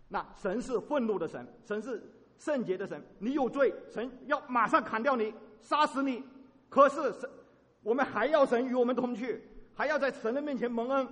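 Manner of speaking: loud, forceful sermon delivery; fiery preaching. Real, not acted. 牧师向会众讲神的烈怒，大声激昂地讲道. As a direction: drive home God's wrath.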